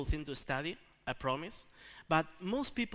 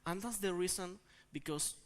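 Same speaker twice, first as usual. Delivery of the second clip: ordinary talk, nothing marked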